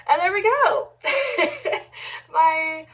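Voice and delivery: bright excited finish with a laugh. Real, not acted. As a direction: cheer it out then laugh.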